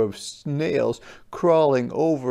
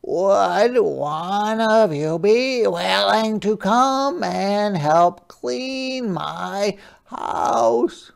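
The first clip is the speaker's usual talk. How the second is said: high, slow, gentle crab voice; a small polite sea creature asking; Hermit Crab voice; 8 semitones higher, 6 dB louder, much slower than usual. Acted. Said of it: "said Hermit Crab".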